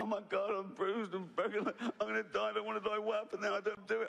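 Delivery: high, babbling survivor's panic; hammed-up high-pitched dread; mock panic. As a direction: gabble as if freezing and about to die. Acting a crash survivor panicking at sea.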